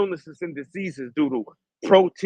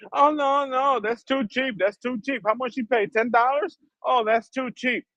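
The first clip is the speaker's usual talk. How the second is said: high, sing-song voice mocking a greedy shopkeeper; mocking cheap shopkeeper; 8 semitones higher than usual. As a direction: mimic a smug seller brushing off the price, pitched high. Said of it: "to see themselves saying".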